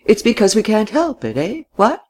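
put-on high, querying old-gentleman voice; old man seeking agreement; earnest old man.

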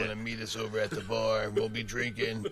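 a hint of a husky barroom DJ; lightly voiced late-night radio host inviting listeners out; scraggly smoker radio voice. Shown as a demonstration. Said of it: "Surprise. Like, I'm very aware".

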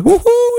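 very high whoop; imitated signal call. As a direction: hoot the secret signal high.

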